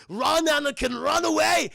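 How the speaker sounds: high, loud warning cry; urgent acted shout. Father sends son fleeing from Sinbad, acted urgent shout. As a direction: yell it as a hammed-up desperate father.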